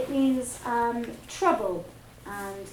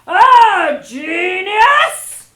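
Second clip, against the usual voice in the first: huge, slow, outraged shout; 9 semitones higher, 22 dB louder, much slower than usual. Acted. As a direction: bellow it in disbelief. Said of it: "she shouted".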